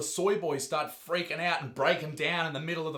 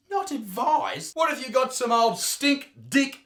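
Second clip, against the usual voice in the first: mock disbelief; 6 semitones higher than usual.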